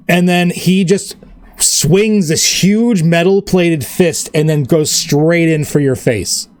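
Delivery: usual speaking voice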